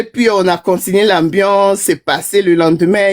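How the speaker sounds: ordinary delivery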